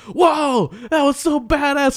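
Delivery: high, loud imitation of a thrilled friend; excited friend. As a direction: whoop it out like an awestruck buddy. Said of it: "He got, like, so excited".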